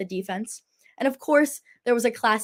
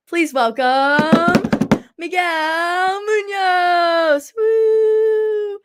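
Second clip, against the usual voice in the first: drawn-out, loud ringside announcer build; big game-show guest introduction; hyped announcer intro; 8 semitones higher, 7 dB louder, much slower than usual. Pretend. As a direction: stretch the name, climbing high into a whoop. Show host hyping guest's entrance.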